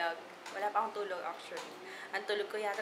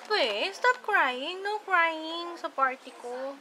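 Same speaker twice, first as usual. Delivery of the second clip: very slow, high, loud coaxing; 7 semitones higher, 7 dB louder, much slower than usual. Real, not acted. Soothing a crying child at party.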